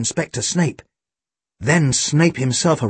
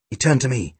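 plain reading voice

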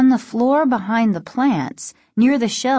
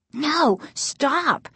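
higher, alarmed protest; crying out; 6 semitones higher than usual. A narrator's reading. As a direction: urgently try to stop someone. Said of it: "Casey cried".

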